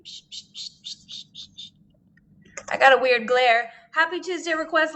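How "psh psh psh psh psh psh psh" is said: spray hiss. Real, not acted. Spraying the lens cleaner.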